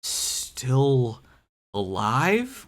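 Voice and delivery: ordinary delivery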